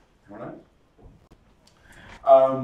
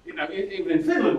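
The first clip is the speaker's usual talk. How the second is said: normal talking voice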